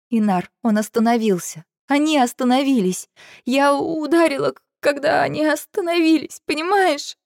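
high, rising, tearful-sounding plea; distraught confession. A narrator's reading. Girl telling her friend they had stopped, distraught. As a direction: stumble upward in guilty panic.

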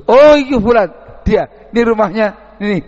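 loud, high burst of recognition; sudden recognition. Acted. A villager hears the nickname and eagerly points out the house. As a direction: blurt it out the moment the name clicks.

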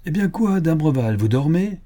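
bantering shout at the door; teasing call. A narrator's reading. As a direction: rouse a sleeping friend. Players knock, teasing sleeper.

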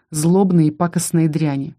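plain reading voice

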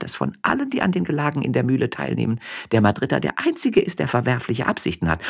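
high, quick gossiping woman; peasant wife voice. A narrator's reading. Josefa gossiping to her husband on the donkey, high chatter. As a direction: pitch up into a chattering farm wife.

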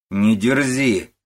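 loud, slow rebuke; scolding. A narrator's reading. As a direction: tell off a cheeky daughter. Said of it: "ругается старуха". (строгий окрик)

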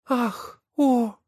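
high gasping cry; amazed. Acted. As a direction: gasp at what she sees. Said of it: "Голос был изумлённый". (изумлённый высокий возглас)

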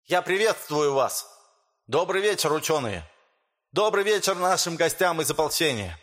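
high, lisping speech with sh for s. Acted. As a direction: lisp through a rousing public speech. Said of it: "По шепелявому голосу".

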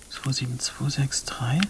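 whispered, reading to herself; wife secretly whispering his call log. Acted. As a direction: whisper so he won't hear. Secretly reading his phone at night.

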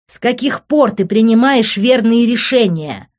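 loud, high-pitched challenge; heated, scornful outburst; angry yelling. A narrator's reading. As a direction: fling the question out in a yell. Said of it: "продолжал он орать".

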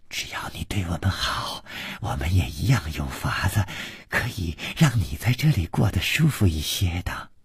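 soft, half-whispered coaxing; infatuated. A narrator's reading. Smitten girl offering him comfort, infatuated murmur. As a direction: coax him dreamily, under your breath. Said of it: "绛衣少女痴痴地说".

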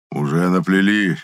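slow, spiteful retort. A narrator's reading. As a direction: draw it out with restrained malice. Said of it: "зло прищурился Анучин".

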